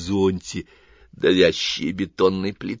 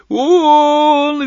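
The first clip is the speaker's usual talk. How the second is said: high, loud shriek; shrieking creature; 11 dB louder than usual. A narrator's reading. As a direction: shriek it in panic. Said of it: "визжало нечто". (визг)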